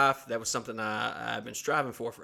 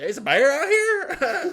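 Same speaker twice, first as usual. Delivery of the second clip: much higher, louder, slow laughing squeal; mock mom; 14 semitones higher, 10 dB louder, slower than usual. Mom coming down the stairs for the bear, high and squealing. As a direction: play mom thrilled about the bear. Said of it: "She's like".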